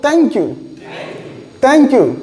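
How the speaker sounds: playful English-accent "thank you"; British-style thank you. Shown as a demonstration. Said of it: "अब बोलो".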